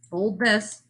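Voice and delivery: normal talking voice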